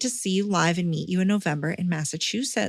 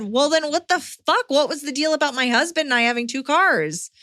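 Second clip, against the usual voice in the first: incredulous outburst; 7 semitones higher than usual. Retold or imitated. Retelling her outraged reply.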